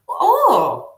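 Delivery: high, falling exclamation of surprise; mock surprise. Retelling the chiropractor's reaction to her arm, mock surprise. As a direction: imitate someone taken aback.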